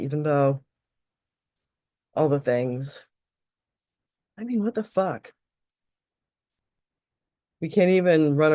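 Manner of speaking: repeat-after-me phrases with a sudden exasperated curse; tapping script. Acted. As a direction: guide the tapping, let the frustration burst out.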